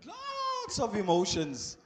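long, emphatic "lots"; stress on lots. Real, not acted. Stretches word for emphasis.

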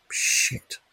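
whispered, breathless curse under her breath; muttered curse. A narrator's reading. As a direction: swear to yourself so no one hears. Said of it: "Ruth muttered".

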